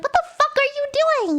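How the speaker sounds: high-pitched mock outrage; retold screaming. Traveller cursing at a silent stranger, mock scream pitched high. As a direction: act out an angry outburst without full volume. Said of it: "I hope the screaming was".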